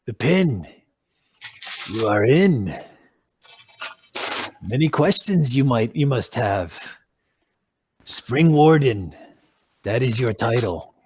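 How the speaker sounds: bestow the title gravely; solemn druid. Acted. Druid granting the party a title, low and solemn.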